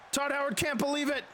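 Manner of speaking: usual speaking voice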